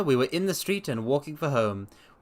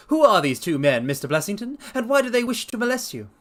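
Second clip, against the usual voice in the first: higher, louder pointed question; probing Holmes; 9 semitones higher, 5 dB louder than usual. Acted. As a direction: press him with the question.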